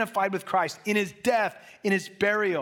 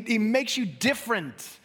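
ordinary talk

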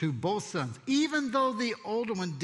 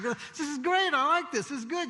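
high, relaxed frog voice; a frog lazing in the warm pot; contented frog; 8 semitones higher than usual. Retold or imitated. Preacher playing the frog lounging in the pot, high and pleased.

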